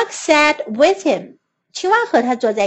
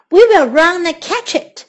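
usual speaking voice

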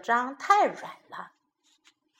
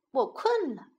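usual speaking voice

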